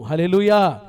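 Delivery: a ringing shout of praise; loud hallelujah. Real, not acted. Preacher to congregation, ringing loud hallelujah. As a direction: call out hallelujah loudly to the church.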